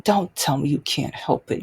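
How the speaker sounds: low-pitched imitation of a stern mother; scolding mother. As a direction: drop the pitch for a restrained maternal rebuke. Retold mother scolding her child.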